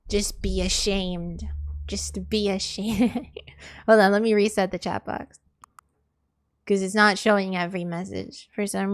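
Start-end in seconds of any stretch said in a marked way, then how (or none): 0.1-3.3 slow scolding. Real, not acted. Scolding chat.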